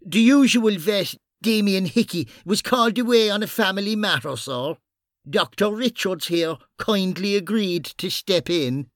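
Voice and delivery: high character voice, smoothly explaining; character addressing a superior. Acted. Bunny covering for the missing vet to his superior, politely explaining. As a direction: pitch it up and explain politely to the boss.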